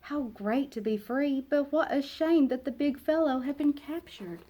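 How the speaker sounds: ordinary delivery, nothing marked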